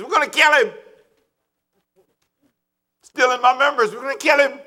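hammed-up jealous threat; jealous religious leaders. Spiritual leaders vowing to kill him, mock menacing. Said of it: "These are the spiritual leaders".

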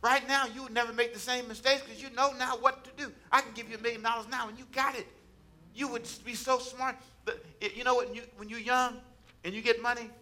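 high-pitched, urgent encouragement from the pulpit; impassioned preaching. Real, not acted. Preacher telling listeners they are smarter now, impassioned preaching.

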